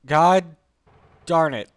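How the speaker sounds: loud, annoyed mutter of God darn it; real gamer frustration; frustrated curse. Real, not acted. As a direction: blurt it out in frustration. Frustrated at game.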